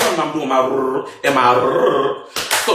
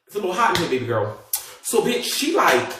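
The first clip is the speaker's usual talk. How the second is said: usual speaking voice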